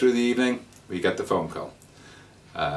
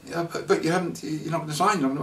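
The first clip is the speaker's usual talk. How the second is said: salesman's shirty, higher-pitched protest; 9 semitones higher than usual. Retold or imitated. Scam salesman protesting as they leave, mildly shirty. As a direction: imitate an irritated pitch man pushing back, pitched higher. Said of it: "he got quite shirty".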